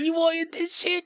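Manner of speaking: high, soft, put-on stoner voice; mock stoner voice. Dying man offering his smoke, in a high mock stoner voice. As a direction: hold the smoke in and offer the hit. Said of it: "Yeah, he's like".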